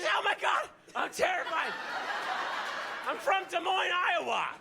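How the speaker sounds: high squealing mock panic; pompous scared Englishman. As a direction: camp terrified Brit. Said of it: "he's English, and he's very pompous".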